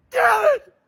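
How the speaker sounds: normal talking voice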